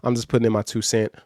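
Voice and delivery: ordinary delivery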